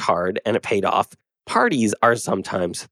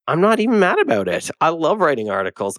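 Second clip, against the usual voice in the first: insistent; 7 semitones higher than usual. Real, not acted. Insists he is fine.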